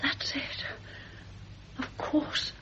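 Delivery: breathless whisper. Acted. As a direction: barely breathe the words. Frightened woman gives in.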